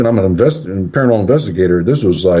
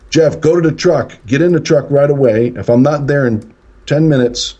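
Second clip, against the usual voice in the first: higher, urgent mock yell; retold yell; 6 semitones higher than usual. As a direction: call out orders to your son, acted lightly. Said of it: "I yell to Jeff".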